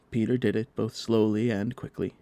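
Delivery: ordinary delivery, nothing marked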